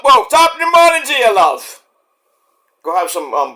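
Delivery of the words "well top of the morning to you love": put-on Irish accent. Jokey morning greeting.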